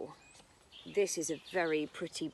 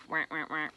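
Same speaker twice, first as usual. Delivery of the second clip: low mock duck quack; teacher imitating a duck for children; 4 semitones lower, faster than usual. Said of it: "What noise does a duck make?".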